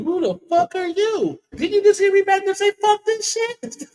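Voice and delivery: high, slow, incredulous guard voice; high mocking disbelief; mock security guard. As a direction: act out the guard demanding who he is. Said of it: "when we gotta talk to you".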